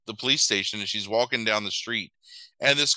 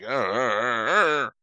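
stuttering, shuddering "uh" noises; mock twitchy stutter; jittery stammered groan; slower than usual. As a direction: act out a twitchy breakdown in one wobbly groan. Acting out the twitching woman's reply.

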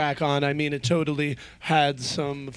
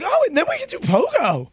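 much higher, gleeful squeal; excited planning; 3 dB louder than usual. Retold or imitated. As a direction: squeal it with delight. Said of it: "I was like".

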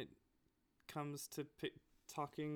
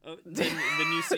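normal talking voice

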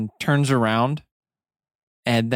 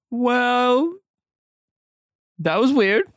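character voice; 11 semitones higher, slower than usual. Acted. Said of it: "Ulrich goes".

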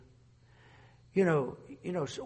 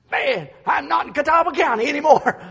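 high, loud cry of disbelief; astonished shout; 10 semitones higher, 11 dB louder than usual. Retold or imitated. Young student in Chicago, shocked shout. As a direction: shout it like a shocked country boy. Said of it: "and I'm, I'm not".

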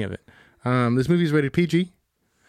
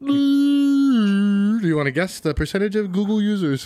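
slow, loud, high dramatic question; gasp, then tease it out slowly; 8 semitones higher, 4 dB louder, slower than usual. Real, not acted. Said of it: "I thought you were gonna sneeze".

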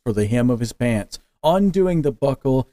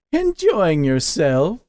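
slow, higher teasing demon; 5 semitones higher, slower than usual. A narrator's reading. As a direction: tease him, slowly and slyly. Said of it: "Casimir teased".

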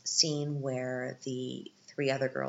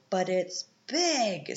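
slow, upbeat dad voice, a bit louder; optimist dad; 5 semitones higher, 5 dB louder, much slower than usual. Retold or imitated. Dad sees the bright side of a leaky house, slow and upbeat. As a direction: drag it out like a cheerful dad selling the upside. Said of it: "always the optimist".

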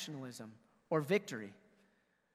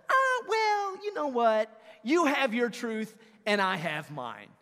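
loud, high mock casual brush-off; hammed-up whatever voice; mock dismissive shrug; 5 semitones higher, 7 dB louder, slower than usual. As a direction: imitate someone dodging the truth. Mocking a relativist reply.

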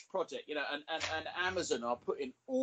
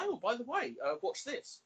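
chirpy delivery man; 9 semitones higher than usual. Pretend. Delivery person's mock sales pitch.

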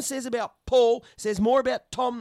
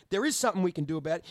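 fast rebuttal; 4 semitones lower, much faster than usual. Real, not acted. Fast rebuttal to mocked quote.

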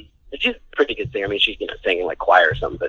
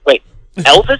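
louder, higher-pitched mock astonishment; retold disbelief; 6 semitones higher, 6 dB louder than usual. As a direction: act out a kid's stunned double take. Retelling childhood disbelief at the news.

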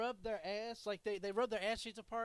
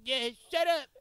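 loud, higher-pitched imitation of her; mock retort; 5 semitones higher, 15 dB louder than usual. Voicing the woman on the receiving end, loud mock retort. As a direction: throw it out loud as a mock comeback. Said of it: "and the bitch is like".

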